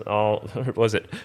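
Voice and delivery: usual speaking voice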